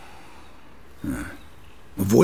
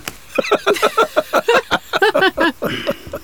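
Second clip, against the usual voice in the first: long laugh; 3 dB louder than usual. Acted. Old author laughs at own joke.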